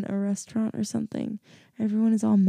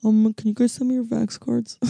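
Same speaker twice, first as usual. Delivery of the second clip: mock official request; 4 semitones higher, 4 dB louder than usual. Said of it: "I just felt really important being like".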